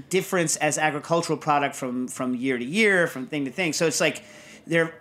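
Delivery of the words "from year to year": normal talking voice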